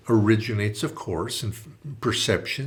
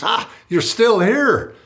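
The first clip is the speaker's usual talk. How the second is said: higher, louder, slow menacing surprise; surprised warlord; 11 semitones higher, 9 dB louder, slower than usual. Retold or imitated. Warlord stunned to find the priest still there, loud. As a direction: let the discovery land slowly with menace.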